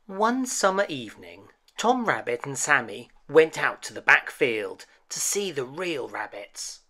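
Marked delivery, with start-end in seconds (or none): none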